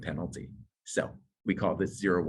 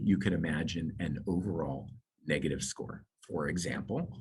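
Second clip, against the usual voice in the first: ordinary delivery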